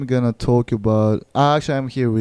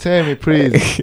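slow, louder, higher mock plea; reenacted disbelief; 4 semitones higher, 6 dB louder, slower than usual. Host retelling his game reaction, as a pleading disbelief. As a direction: plead for an explanation, drawing it out. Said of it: "I was like".

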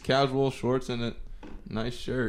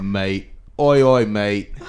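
loud, hammed-up English lad; badly faked English accent, called out loud; 10 dB louder, much slower than usual. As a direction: shout it like a mock English bloke. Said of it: "You sound straight awful".

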